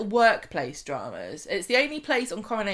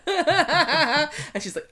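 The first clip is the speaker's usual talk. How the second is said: laughing; 7 semitones higher, 4 dB louder, much slower than usual. Real, not acted. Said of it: "laughing while talking".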